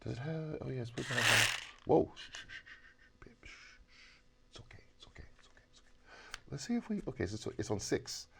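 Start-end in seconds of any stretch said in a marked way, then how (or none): 2.1-3.8 soft hushing shh; soothing shushing. Acted. Hushing the angry toy. 4.5-5.9 soft soothing whisper; calm a frightened creature; whispered reassurance. Acted. Soothing the device.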